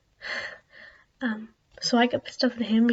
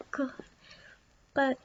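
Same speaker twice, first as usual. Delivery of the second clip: normal talking voice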